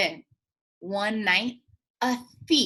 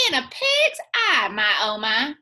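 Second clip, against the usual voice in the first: scoffing granny; 4 dB louder than usual. Acted. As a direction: scoff it off, the old lady's way. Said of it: "and said".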